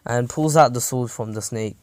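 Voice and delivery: ordinary delivery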